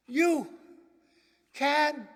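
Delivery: the preacher's exhortation soaring at the end; preaching climax. Real, not acted. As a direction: build to a ringing jump. Climax of sermon appeal.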